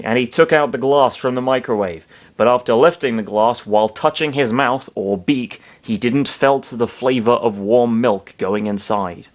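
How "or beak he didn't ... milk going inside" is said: ordinary delivery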